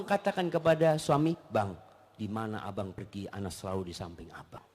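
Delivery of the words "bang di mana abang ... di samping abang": tender wife. Acted. Advised tender words to husband.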